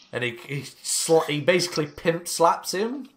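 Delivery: ordinary talk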